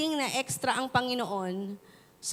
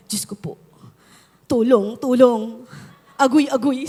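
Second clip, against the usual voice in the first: hammed-up cry for help; 8 dB louder than usual. Song leader fallen off the stage crying for help into the mic. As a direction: ham up a fallen man calling for help. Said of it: "Sabi niya".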